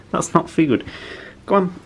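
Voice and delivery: high mild telling-off; gentle scolding to pet. Real, not acted.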